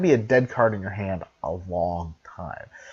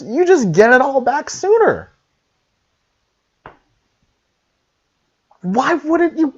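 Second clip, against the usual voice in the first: high, loud and gleeful; excited; 11 semitones higher, 7 dB louder than usual. Real, not acted. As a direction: hype the verdict up. Gleeful ten out of ten verdict.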